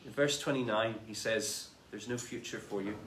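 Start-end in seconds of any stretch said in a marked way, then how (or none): 1.9-3.0 quiet, grave verdict. Acted. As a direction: lower and much softer, a solemn sentence. Master condemning third servant.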